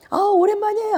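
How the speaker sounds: very high, sing-out greeting; bright-toned exaggerated high hello. Shown as a demonstration. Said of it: "좀 톤을 올려라".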